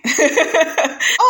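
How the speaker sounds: a high, squealing laugh; burst of laughter. Real, not acted. Host laughing at the show, high squealing laugh.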